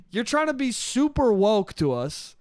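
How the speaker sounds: usual speaking voice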